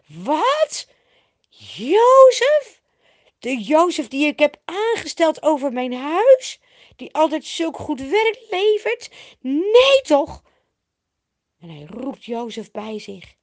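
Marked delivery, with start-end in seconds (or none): 0.1-10.4 loud, high incredulous questioning; dismayed disbelief. A narrator's reading. Potifar hears his wife's tale about trusted Jozef, dismayed disbelief. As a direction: refuse to believe it, voice leaping up. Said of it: "En de teleurstelling staat in zijn ogen".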